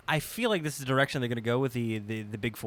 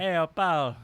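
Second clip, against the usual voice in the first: lightly put-on Quebec French accent; a quick French Canadian Pat Patterson voice; 4 semitones higher, 4 dB louder, slower than usual. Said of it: "that weird French Canadian".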